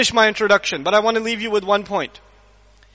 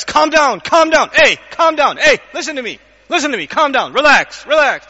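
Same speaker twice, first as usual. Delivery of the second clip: raised, loud pleading to calm down; urgent calm down; 3 semitones higher, 5 dB louder than usual. Retold or imitated. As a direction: urge a furious friend to calm down, loud and higher. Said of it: "he says".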